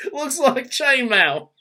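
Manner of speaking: high and slow, laughing through the words; laughing mock kid voice. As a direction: echo the kid line while cracking up. Echoing the joke while laughing.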